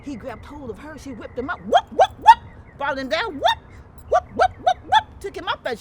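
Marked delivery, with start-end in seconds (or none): 1.6-5.1 high loud whoop cries; whipping sounds. Acted. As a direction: belt out the whip noises. Acting out the whipping.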